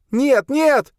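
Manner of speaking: very high, loud refusal; high-pitched frantic cry; screaming. A narrator's reading. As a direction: scream it in panic. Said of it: "закричала Долорес".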